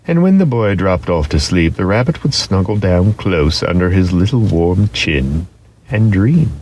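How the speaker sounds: plain reading voice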